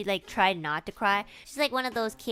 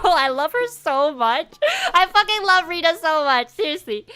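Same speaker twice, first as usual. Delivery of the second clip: loud, high burst of adoration; excited gushing; 8 semitones higher, 9 dB louder than usual. Real, not acted. Gushing about a favourite kid, loud and excited. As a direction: gush with real enthusiasm, louder and higher.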